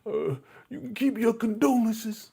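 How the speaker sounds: high, huffy imitated Sonya; sassy Sonya. Sonya rebuffing Brother Elijah, high huffy sass. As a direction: play her snapping back offended. And she just like.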